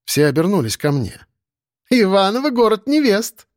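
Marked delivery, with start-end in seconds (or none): 1.9-3.5 high, playful teasing voice; laughing teasing. A narrator's reading. Neighbour joking about the city of brides, amused teasing. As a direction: tease from the next table, pitched up. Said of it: "засмеялась курчавая соседка".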